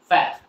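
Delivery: "ফ্যাক্ট" stopped after the vowel, no T heard; silent final T. Shown as a demonstration. Teacher repeats fat as "ফ্যাক্ট", T silent. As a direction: swallow the end of "ফ্যাক্ট". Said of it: "টি is silent".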